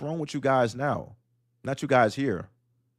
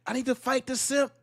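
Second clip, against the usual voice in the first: high, whiny mock of a torn-up man; mock simp voice; 11 semitones higher than usual. Mocking men who can't fight the simp, high whine. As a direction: squeak it out like a weak man begging himself. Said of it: "I'm like".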